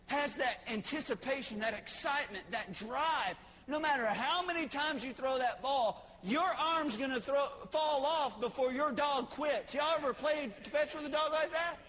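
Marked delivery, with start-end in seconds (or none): none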